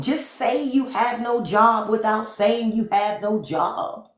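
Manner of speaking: high, slow sarcastic roast; sarcastic jab. Real, not acted. Roasting a viewer, slow and sarcastic. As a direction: lay the burn on slowly.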